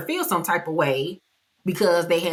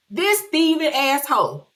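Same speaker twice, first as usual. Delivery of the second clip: higher, louder repeat of the insult; 7 semitones higher than usual. Retold or imitated. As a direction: say it again with more heat. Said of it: "I said".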